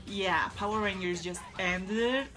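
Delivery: usual speaking voice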